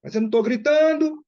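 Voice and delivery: loud, high-pitched yell in protest; acted outburst, voice shooting way up; indignant shouted denial. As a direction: yell it while denying you are yelling. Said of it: "se você sentir que o seu nível de voz".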